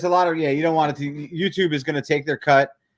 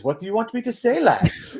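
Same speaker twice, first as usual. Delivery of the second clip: a bad, half-hearted British accent, a bit higher; mock British accent; 8 semitones higher than usual. Friend mocking the streamer with a terrible British accent. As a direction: imitate a Brit badly. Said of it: "That was not even close to British".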